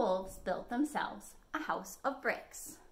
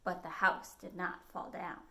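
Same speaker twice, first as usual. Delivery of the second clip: plain reading voice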